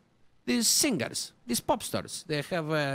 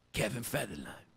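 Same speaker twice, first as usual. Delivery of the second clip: ordinary delivery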